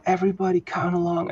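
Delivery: high-pitched call to the audience; countdown host. Retold or imitated. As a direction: imitate a host rallying a crowd to count. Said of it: "a countdown to the movie".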